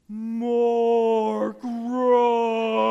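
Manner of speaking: loud, high, drawn-out yell; coach shouting. Retold or imitated. Third base coach yelling at the runner to go, loud. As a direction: wave him home with your voice. Coach calling him home.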